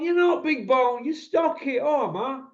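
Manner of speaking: high, slow mocking reply; blunt relative. Relative scolding Russell again, high and blunt. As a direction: tell him straight. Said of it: "relative replying".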